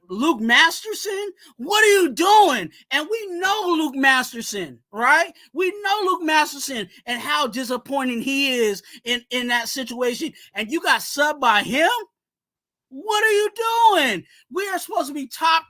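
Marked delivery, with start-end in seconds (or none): 1.6-2.7 exasperated shout. Real, not acted. Fan calling out Divine Deablo, exasperated shout. Ranting at a linebacker. 12.9-14.2 exasperated outburst. Real, not acted. Ranting at a linebacker.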